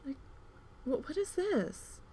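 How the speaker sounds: high-pitched retold bewilderment; confused disbelief. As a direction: question it in puzzled disbelief, voice lifting. Retold confused reaction to wrong voice.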